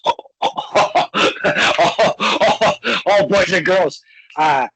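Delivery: loud laughter through the words; cracking up loudly while greeting; laughing. Real, not acted. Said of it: "So funny".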